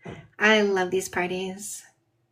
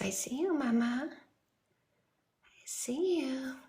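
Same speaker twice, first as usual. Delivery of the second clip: dog's voice; 2 semitones higher, 12 dB quieter, much slower than usual. Pretend. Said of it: "Charlie says".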